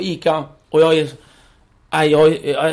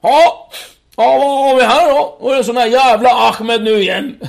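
higher, louder mocking cop voice; smug Swedish cop; 7 semitones higher, 6 dB louder than usual. A cop at the shop, higher and louder in mockery. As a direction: play the cocky cop sneering at the kid. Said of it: "sköna svenska snutar".

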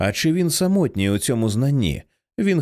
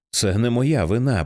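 ordinary delivery

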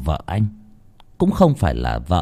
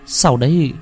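usual speaking voice

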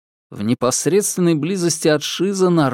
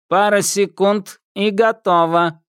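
metallic patrolman; 8 semitones higher, 3 dB louder than usual. Acted. Patrolman tests Thomas.

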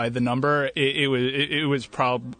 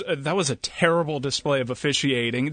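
usual speaking voice

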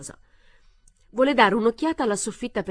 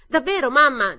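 hysterical; 7 semitones higher, 9 dB louder than usual. A narrator's reading. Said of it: "era isterica".

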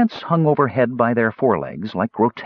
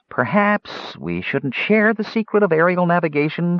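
hesitant; 8 semitones higher than usual. Acted. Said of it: "Fitter said hesitantly".

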